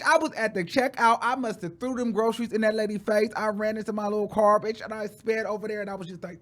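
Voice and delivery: normal talking voice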